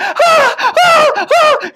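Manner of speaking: high laughing screams; half-laughing repeated high yells; screaming. Acted. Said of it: "he just kept screaming".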